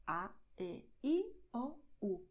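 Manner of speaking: "A, E, I, O, U" as pure Spanish vowels. Shown as a demonstration. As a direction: say "A", "E", "I", "O", "U" separately, Spanish style. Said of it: "we're going to pronounce them".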